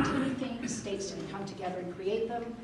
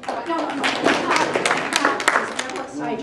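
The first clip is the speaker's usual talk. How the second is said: loud sharp voice; 5 semitones higher, 12 dB louder than usual. Real, not acted. Calling out over the crowd.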